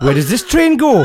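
high, singsong mock Welsh; botched Geordie that comes out Welsh; accidental Welsh accent. Storyteller voicing neds on the train, accidental Welsh accent. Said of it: "So we're in Wales now".

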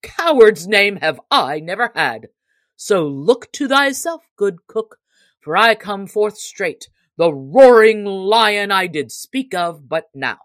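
roaring boast. A narrator's reading. Little John answering the cook's insult, loud roar. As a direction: roar it out like a lion. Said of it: "cried Little John".

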